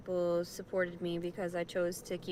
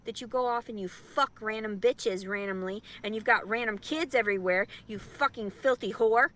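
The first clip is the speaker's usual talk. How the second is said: from raised to loud, angry insults; building angry rant; 5 dB louder than usual. Real, not acted. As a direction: rant harder and louder to the end. Ranting insults at the father.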